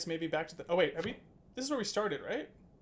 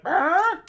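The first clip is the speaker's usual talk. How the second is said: high, loud mock brr; a squeaky disgruntled brr; imitated grumble; 13 semitones higher, 14 dB louder than usual. Streamer imitating a card player annoyed at overbidding, loud squeaky brr. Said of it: "he's like".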